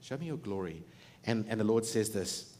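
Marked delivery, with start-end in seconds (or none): 0.0-0.8 quieter, longing request; hushed, earnest Moses; soft plea. Retold or imitated. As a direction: ask softly, yearning. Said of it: "He says".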